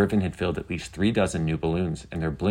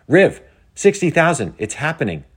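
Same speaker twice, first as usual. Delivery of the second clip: high, loud burst of disbelief; thrilled exclamation, easing slightly; excited; 10 semitones higher, 8 dB louder than usual. A narrator's reading. Penny tells Riven about the viewer spike, excited.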